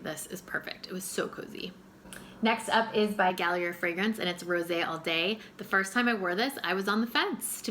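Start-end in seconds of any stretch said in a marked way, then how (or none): 0.0-1.7 cozy whisper. Retold or imitated. Remembering a rainy day in her armchair, whispered. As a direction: whisper it, hushed and content.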